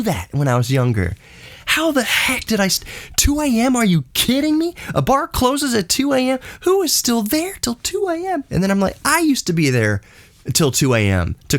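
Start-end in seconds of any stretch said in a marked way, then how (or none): 1.7-8.4 high, squeaky disbelief; can't believe you ever did that; pitched way up in mock outrage; incredulous. Real, not acted. Disbelief at staying up late.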